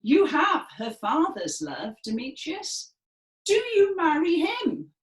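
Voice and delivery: mock him for the father's favor; furious Lysander. Shown as a demonstration. Class chorus as Lysander taunting Demetrius, furious. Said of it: "let's all say that fantastic Shakespeare line".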